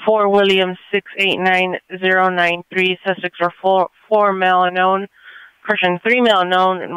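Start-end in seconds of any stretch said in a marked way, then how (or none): 0.0-3.0 slower, each digit spelled out; slow careful repeat. Real, not acted. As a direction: repeat the plate slowly, digit by digit. Plate repeated for clarity.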